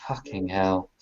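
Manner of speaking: low, hushed mock despair. Portia despairs at the others, low despairing mutter. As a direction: sink into a quiet, despairing curse. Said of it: "gives you the most despairing".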